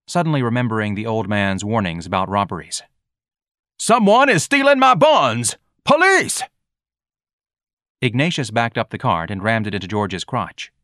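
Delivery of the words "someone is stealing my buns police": high, loud, drawn-out hollering; alarmed scream. A narrator's reading. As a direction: scream for help as if being robbed. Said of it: "Ignatius screamed".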